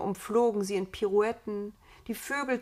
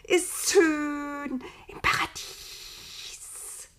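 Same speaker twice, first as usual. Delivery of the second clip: high, slow, sibilant lament; plaintive snake voice, very slow; voiceless hissing creature; 8 semitones higher, much slower than usual. A narrator's reading. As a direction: draw out each word into a long serpent hiss. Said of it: "es sei ohne Stimme".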